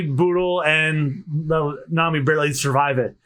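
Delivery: ordinary delivery, nothing marked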